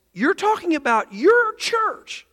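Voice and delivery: high, drawn-out astonished question; retold astonishment. Preacher replying to his friend's revival news, astonished. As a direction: stretch it out in high-pitched disbelief.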